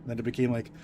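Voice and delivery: ordinary delivery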